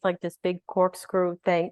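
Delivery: normal talking voice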